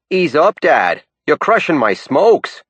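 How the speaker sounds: high, slow put-on Maine girl; hammed-up high Maine girl voice. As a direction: squeal it out like a Down East girl. Punchline voicing Maine girl.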